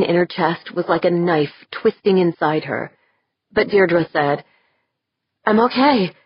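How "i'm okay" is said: gasped out. Acted. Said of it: "gasp out the two words".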